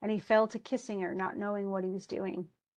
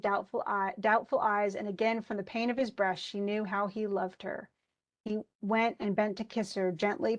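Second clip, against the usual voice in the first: ordinary talk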